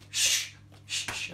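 long hushing "shh"; shushing. Pretend. Storyteller settling children before the story, shushing.

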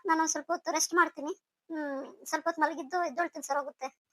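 soft, drained voice; weak, subdued. Acted. Unwell daughter asks her parents to let her rest, subdued. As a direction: trail along quietly, wanting only rest.

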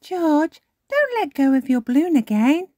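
put-on high, loud little-girl voice; bossy Peppa. A narrator's reading. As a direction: scold your brother. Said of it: "says Peppa".

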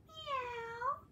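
high, slow kitten mew; tiny pleased kitten. Acted. Grandma reading, kitten finds food, high kitten voice. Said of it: "said the hungry little kitten".

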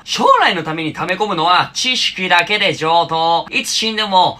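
usual speaking voice

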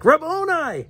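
sudden high joyful cry; cried out. Acted. Mary recognizing the risen Jesus, crying out high. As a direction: call the name out in leaping recognition. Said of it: "cried out in Aramaic".

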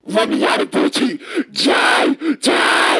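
loud, frantic battle cry; furious shout. Acted. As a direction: scream at the enemy in rage. Hamster raging at cat.

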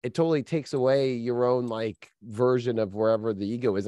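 ordinary talk, nothing marked

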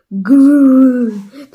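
loud growling grrr; Yeti growl. A narrator's reading. Yeti bursting into the hall, loud growl. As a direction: growl like a big beast. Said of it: "the Yeti growled".

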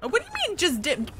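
high-pitched offended "what do you mean"; indignant surprise. Real, not acted. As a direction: react in mock outrage. Indignant reaction.

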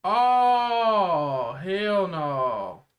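sudden loud oh, hell no; shocked. Real, not acted. Watching the episode, shocked at the creepy dude. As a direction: recoil at the sight.

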